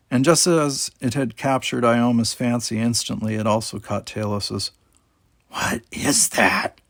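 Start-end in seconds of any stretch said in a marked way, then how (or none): 5.5-6.8 slow, awed whisper; breathed whisper. A narrator's reading. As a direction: breathe it out in wonder. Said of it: "she breathed".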